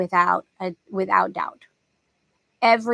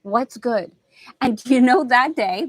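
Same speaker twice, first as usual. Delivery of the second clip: ordinary delivery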